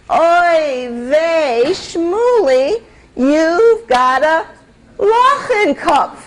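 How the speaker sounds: hammed-up Yiddish mother lament; exasperated mother. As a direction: wail it out in despair at the foolish son. Exasperated mother scolding son.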